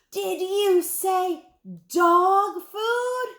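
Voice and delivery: high, loud, drawn-out disbelieving shriek. A narrator's reading. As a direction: stretch each word into an outraged shriek. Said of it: "a voice that could be heard three aisles away".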